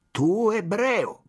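slow sneering accusation. A narrator's reading. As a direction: drawl the insult.

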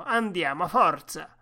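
loud urging, building in force; shouted command. A narrator's reading. Driver whipping up his sled team, loud shout. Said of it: "gridò".

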